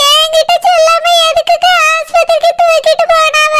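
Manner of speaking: tearful wailing. Acted. Distraught mother demanding why nobody told her, wailing. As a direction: wail in panic for your child. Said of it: "அழாத சிலப்பா".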